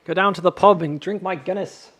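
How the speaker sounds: playful put-on Irish accent; teasing Irish accent. Shown as a demonstration. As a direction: do a jokey Irish pub-goer's brogue. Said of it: "I have a pretty decent Irish accent".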